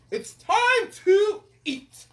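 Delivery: loud, high, stretched-out order; commanding king. Acted. King tells the cook the next job, commanding. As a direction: proclaim it slowly and grandly.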